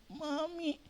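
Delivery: small soft whimpered plea; a hurt child calling out softly; child whimpering. Retold or imitated. Crying child calls mother.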